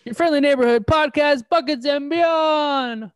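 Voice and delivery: slow, loud, high hype announcer. Acted. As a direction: belt it out like a ring announcer. Announcing the podcast intro.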